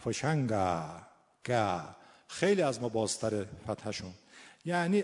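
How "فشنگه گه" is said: Lur "فشنگه" ending in an open a; Lur-accented, drawn-out final a. Shown as a demonstration.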